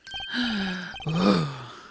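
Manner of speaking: long, audible gasp; drawn-out gasp of shock; dramatic gasp. Acted. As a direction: suck in a startled breath. Dramatic gasp on seeing someone.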